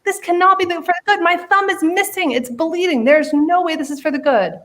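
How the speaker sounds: high-pitched, indignant tirade; king losing it. A narrator's reading. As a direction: rant like an outraged king. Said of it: "the king loses it".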